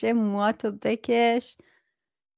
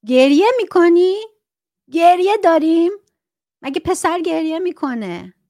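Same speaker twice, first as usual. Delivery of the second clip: much higher and louder scornful parent; mock scolding parent; 7 semitones higher, 9 dB louder than usual. Parent scolds a crying son, loud mocking scorn. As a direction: sneer at the boy like a harsh father.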